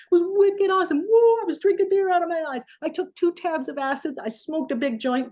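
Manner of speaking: high, rowdy Boston party-guy voice; thick Boston accent. Retold or imitated. Boston kid bragging to [name] after the show, rowdy Boston accent. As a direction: brag loudly like a wasted Boston kid. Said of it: "with his thick Boston accent".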